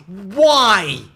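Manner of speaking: loud, exasperated wail; exasperated shout. Real, not acted. Streamer despairing at crap not working, shouting. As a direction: cry out at the failing build. Exasperated over failing build.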